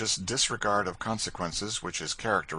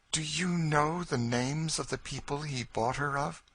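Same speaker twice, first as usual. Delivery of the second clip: higher, hushed intent woman; tense woman's question; 9 semitones higher than usual. Acted. Cassie touching George's arm, higher and intent. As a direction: ask intently, holding her breath.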